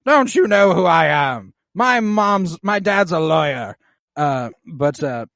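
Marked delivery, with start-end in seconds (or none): 0.1-3.8 prissy, indignant brat pitched high and loud; spoiled prince. Retold or imitated. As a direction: squeal it like an entitled rich kid. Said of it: "Aegon goes".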